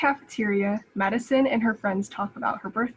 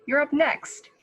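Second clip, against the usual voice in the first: raised, higher call across the playground; 6 semitones higher, 4 dB louder than usual. Acted. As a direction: call him over from across the court. Calling across the tetherball court.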